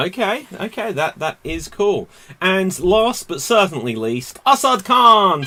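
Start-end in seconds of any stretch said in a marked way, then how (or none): 2.4-5.4 slow, rising ring announcer call; announcer shout. Acted. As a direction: belt out the name like a ring announcer. Host announces final contestant.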